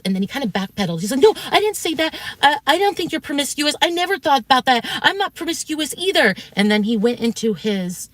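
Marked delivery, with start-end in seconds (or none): 1.2-6.3 high, louder mock-defensive voice; mocking his backpedal. Telling viewers how he denied it, mocking high voice. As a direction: parody a man scrambling to take it back.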